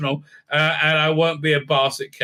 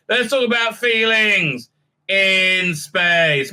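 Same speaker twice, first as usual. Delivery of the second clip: slow mock tagline; much slower than usual. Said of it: "sarcastic slow tagline".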